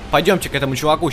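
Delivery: ordinary talk, nothing marked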